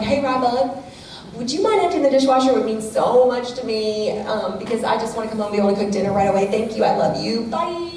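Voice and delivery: high, quick, sweet request; sweet ask. Shown as a demonstration. Mother redoing a request to her daughter, sweet and high. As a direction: coax her gently with love. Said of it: "Okay, redo".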